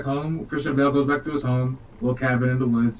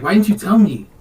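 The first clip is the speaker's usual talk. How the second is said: loud, high mock complaint; hammed-up hurt demand; aggrieved protest; 6 semitones higher, 8 dB louder than usual. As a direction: protest like a betrayed buddy. Acted hurt friend in retold quarrel.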